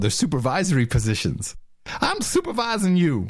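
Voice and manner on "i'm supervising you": lightly voiced, higher mock supervisor. Mocks supervisors in clip.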